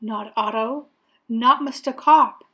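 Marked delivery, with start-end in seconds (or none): none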